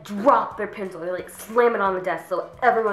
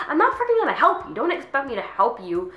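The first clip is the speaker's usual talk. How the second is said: high, raised-voice protest; exasperated refusal; 6 semitones higher, 4 dB louder than usual. Acted. To classmate expecting answers, exasperated. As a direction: put your foot down, pitched way up.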